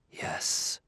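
whispered, defeated admission; barely audible. A narrator's reading. Haunted roboticist admitting it is possible, barely audible. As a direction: let it out as a faint whisper. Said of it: "in a voice so low that Bailey scarcely heard him".

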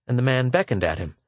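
ordinary delivery

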